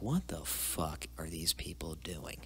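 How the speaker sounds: slow, hushed, unvoiced aside; whispered thought. At his first family dinner, baffled whisper. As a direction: breathe it like a private thought.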